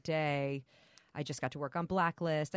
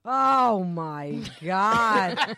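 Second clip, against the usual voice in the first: loud, aggrieved hammed-up grandma; 3 semitones higher, 9 dB louder, slower than usual. Grandmother learning grandson is uncircumcised, loud comic dismay. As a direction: lament it like an outraged bubbe.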